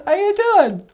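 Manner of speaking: very high, bright greeting; a cheerful high puppet hello; [name]'s puppet voice. Acted. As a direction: pitch way up for the puppet.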